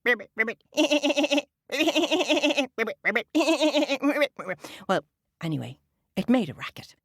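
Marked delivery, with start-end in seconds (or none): 0.1-4.6 high ribbits broken by laughter; laughing frog. Shown as a demonstration. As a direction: laugh and croak together. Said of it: "the laughing of a frog would go".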